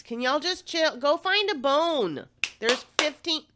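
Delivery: high, loud exasperated scolding; firm call to noisy dogs. Real, not acted. Scolding dogs mid podcast.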